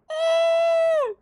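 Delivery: very high, sustained whoop; high held cry of amazement; excited squeal. Real, not acted. Reacts to a big moment.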